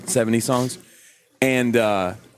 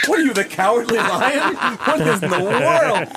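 mock Cowardly Lion; 9 semitones higher, slower than usual. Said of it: "It's like".

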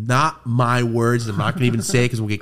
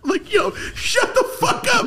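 high-pitched, laughing disbelief; incredulous. Retold or imitated. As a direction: squeal it as if you can't believe it. Acts out own disbelieving reaction.

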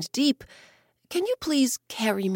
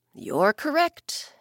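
bear voice; 7 semitones higher, slower than usual. Acted. Bear answers, smiling.

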